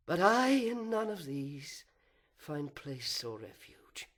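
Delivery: a proud fallen angel brooding aloud, swinging from whispers to outbursts; fallen angel's soliloquy. Acted. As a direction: seethe with envy and wounded pride, then spit out the vengeance.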